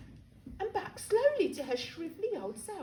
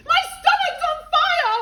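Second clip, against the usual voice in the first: much higher, louder wail; panicked shout; 13 semitones higher, 11 dB louder than usual. Acted. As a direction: cry out in alarm.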